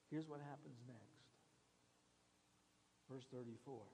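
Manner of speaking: ordinary talk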